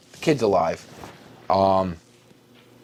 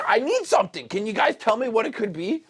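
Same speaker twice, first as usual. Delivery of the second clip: much higher, pleading voice; asking the Ravenclaws; 11 semitones higher than usual. Retold or imitated. Harry asks the Ravenclaws for help, in a squeaky pleading voice. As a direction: squeak it up high like a desperate kid. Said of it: "I'm like".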